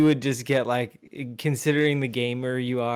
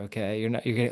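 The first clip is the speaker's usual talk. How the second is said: normal talking voice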